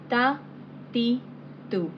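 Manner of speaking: "Ta, ti, tu" in a thin light tone; light thin Arabic ta, ti, tu; Arabic syllables. Shown as a demonstration. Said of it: "Ta or ta will be wrong pronunciation".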